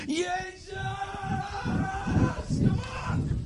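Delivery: high, drawn-out cry to God; hoge uitroep naar God. Shown as a demonstration. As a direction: call out to the Lord high and long, held back in volume. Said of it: "mijn manier van soken bij de Heer is". (hoge, langgerekte roep naar de Heer)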